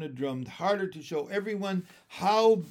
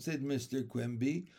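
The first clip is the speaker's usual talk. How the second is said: usual speaking voice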